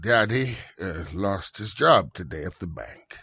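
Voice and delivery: sad. Acted. Father confesses job loss.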